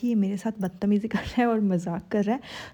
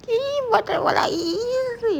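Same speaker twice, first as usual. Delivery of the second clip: squealing high and slow with a laugh; giddy squeal; much slower than usual. Real, not acted. Vlogger gushing at the couple, giddy squeal. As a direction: melt into a giggly, high-pitched squeal.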